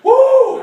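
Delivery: very high, loud woo; wild acted holler; rodeo whoop. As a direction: whoop while hanging on. Riding a bucking dog.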